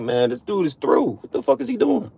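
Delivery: high, put-on voice of a disapproving Lord; mock divine disgust, pitched high; the Lord looking down. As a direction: play God shaking his head at you. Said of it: "The Lord be looking down on us like".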